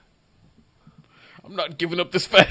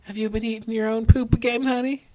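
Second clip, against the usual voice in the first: mock mom voice; 3 semitones higher than usual. Voicing the mother.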